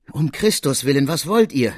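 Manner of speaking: high, loud terrified cry; frightened scream. Acted. The apprentice screams at the murderer entering his room. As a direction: shout him back in fear. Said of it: "schrie ich ihm entgegen".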